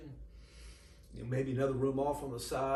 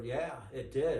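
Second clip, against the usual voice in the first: usual speaking voice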